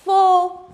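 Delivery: Four high and loud, "oh" held; teacher's model फो with a stretched "oh"; long O sound. Shown as a demonstration. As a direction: draw out फो as "fohh". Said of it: "इसका उच्चारण क्या निकलेगा".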